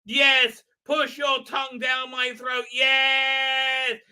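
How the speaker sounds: high, loud mock-swooning yes; a loud, high hammed-up yes; mock passion. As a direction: ham up the eager reply, high and loud. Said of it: "the answer's like".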